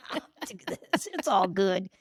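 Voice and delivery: laughing through a breezy it's-fine; mock-cheerful self-denial with a giggle. Mocking her past self while ignoring red flags, laughing.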